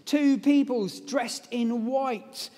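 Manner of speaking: usual speaking voice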